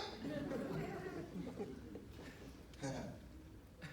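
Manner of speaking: high airy laugh. Real, not acted. Storyteller laughing at the punchline. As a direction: laugh at your own story.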